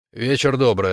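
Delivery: ordinary delivery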